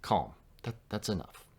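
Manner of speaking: put-on soft, hushed words to settle a child; gentle parent calming a child; soothing a child. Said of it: "like if you're speaking to a child".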